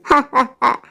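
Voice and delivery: low, soft evil cackle; evil witch cackle. Acted. Witch laughs at victim. (नीची, धीमी बुरी चुड़ैल की हँसी)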